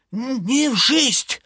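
high, slow stuttering excuse; frightened stammer. Acted. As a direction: stammer out of fear.

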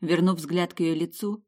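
plain reading voice

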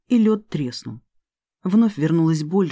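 plain reading voice

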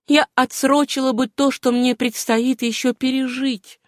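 high, earnest woman's voice; Suzanne's voice. Acted. Героиня откровенно объясняет отказ. (высокий, взволнованный голос героини)

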